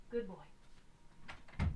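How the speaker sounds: whispered praise. Real, not acted. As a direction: breathe it softly to the cat.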